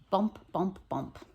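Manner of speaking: thumping bump, bump, fading; mock bass thump. Teacher mimicking the neighbours' party music for her pupils. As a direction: drum out the party beat.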